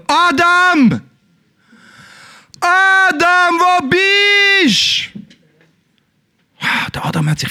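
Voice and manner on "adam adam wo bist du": high, drawn-out distant call; God calling. Acted. God calling for hidden Adam, high and very slow. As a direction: call out long and high across the garden. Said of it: "Und er hat gerufen".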